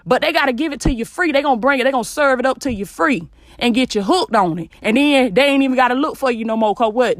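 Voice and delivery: ordinary delivery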